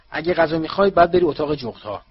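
sharp, annoyed telling-off; irritated snap. Acted. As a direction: shoo the owl off crossly. Boy snaps at his owl.

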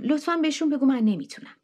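quick, urgent begging; hurried plea. Acted. Jinny urgently begging Neil to excuse her, quick. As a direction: rush out the plea to be spared.

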